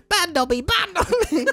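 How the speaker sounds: very high squeaky house elf voice; squeaky Dobby voice. Acted. Reading the house elf's line to friends, squeaky high. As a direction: pitch way up into a squeaky elf falsetto. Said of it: "doing the voices and everything".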